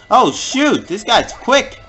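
scrambling to keep up; alarmed. Real, not acted. Gamer caught out by a quick foe, high alarmed exclaim. Enemy moves fast.

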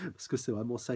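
normal talking voice

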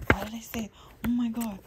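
loud, high disbelieving cry; shocked outburst. Real, not acted. Reader startled by her own words, loud amazed outburst. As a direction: burst out in real astonishment.

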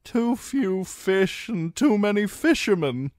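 gloomily. A narrator's reading. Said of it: "said gloomily".

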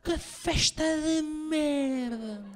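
slow, high drawn-out complaint; high, slow laughing gripe; disgusted groan. Retold or imitated. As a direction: stretch it out in disgust. Disappointed at the wake party.

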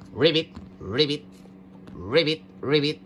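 low paired ribbits, each rising; frog croaking. Pretend. Toy frog among the pool animals, low playful croaking.

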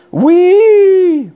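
high, loud delighted whee; gleeful whee. Acted. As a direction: ride the spinning chair with joy. Spinning in the chair.

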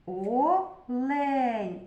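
Олень slowly, with a long held е; drawn-out Е. Shown as a demonstration. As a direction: stretch the е in Олень to single it out.

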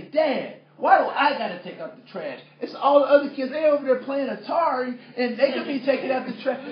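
loud, high-pitched whine of protest; complaining teenager. Retold or imitated. Son complains the other kids play Atari, indignant. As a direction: imitate a kid crying unfair to dad. Son's protest in retold quarrel.

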